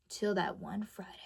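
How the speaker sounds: dramatic hushed whisper; suspense whisper. Acted. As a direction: drop to a whisper to build suspense. Dramatic suspense before the bad day.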